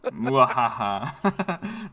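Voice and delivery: slow, drawn-out villain cackle; evil laugh. Acted. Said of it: "The evil laugh".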